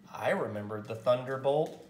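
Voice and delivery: usual speaking voice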